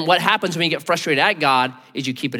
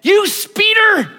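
high, slow, drawn-out mock scold; squeaky, stretched-out accusation; mock outraged driver; 8 dB louder, much slower than usual. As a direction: sneer it like an indignant driver. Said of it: "What do you think in that moment?".

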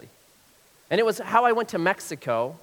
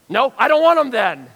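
mock indignant refusal; 7 semitones higher, 9 dB louder than usual. Mock refusal to roommate's pens.

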